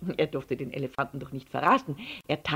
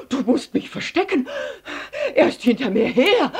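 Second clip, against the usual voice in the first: atemlos nach Luft japsend, gehetzt und hoch; außer Puste um Hilfe flehen; jappend; 8 semitones higher, 6 dB louder than usual. A narrator's reading. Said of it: "er jappte nach Luft". (nach Luft schnappend, aufgeregt)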